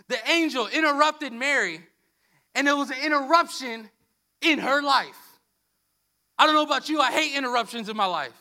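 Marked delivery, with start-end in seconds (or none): none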